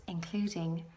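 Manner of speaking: ordinary talk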